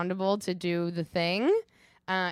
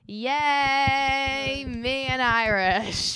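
excited; 7 semitones higher, 5 dB louder, much slower than usual. Real, not acted. Excited podcast shoutout.

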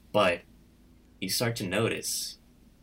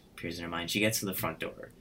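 normal talking voice

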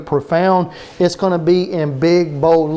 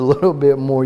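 ordinary talk, nothing marked

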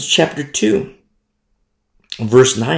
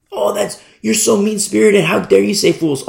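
higher-pitched put-on indignation; mock offended listener; 6 semitones higher than usual. Imagined listener scolding the preacher for saying fools, mock-offended. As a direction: object like a scandalized churchgoer, hammed up.

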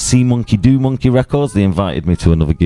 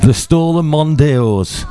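slow, raised-pitch Yorkshire accent; 7 semitones higher, slower than usual. Shown as a demonstration. Podcaster finding his accent for a band tagline, Yorkshire accent. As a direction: draw it out in Yorkshire. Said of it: "let me get a Yorkshire accent".

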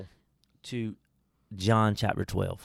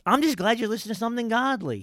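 excited; 12 semitones higher, 6 dB louder, faster than usual. Real, not acted. Preaching high and fast.

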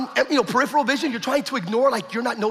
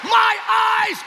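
very high, slow cry of amazement; shouted imitated testimony; 12 semitones higher, 4 dB louder, slower than usual. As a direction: preach it out at full force. Father's astonished testimony, acted fervently.

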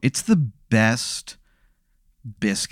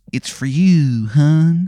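put-on Baltimore accent; 3 semitones higher, much slower than usual. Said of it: "the worst Baltimore accent".

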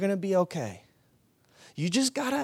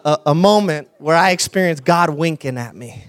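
ordinary talk

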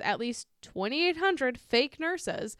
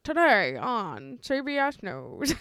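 slow, booming anchorman read; news anchor voice; 2 semitones higher, 5 dB louder, slower than usual. Shown as a demonstration. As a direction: announce it like an evening news anchor. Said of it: "a good news anchor voice".

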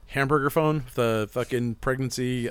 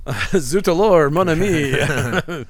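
put-on hammy, higher, louder French accent; bad French accent; 4 semitones higher, 6 dB louder, much slower than usual. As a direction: ham up a cartoon French accent, slow. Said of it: "speaking of bad French accents".

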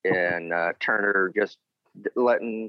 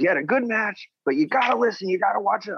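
high, raised voice of a lecturing boss; scolding Arn; 12 semitones higher, faster than usual. Retold or imitated. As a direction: play the boss chewing him out. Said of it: "He goes".

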